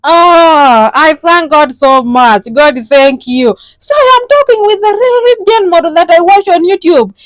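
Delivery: high, loud, gushing caller; overjoyed caller. Acted. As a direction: gush with relief at getting through. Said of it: "those are the kind of calls I get".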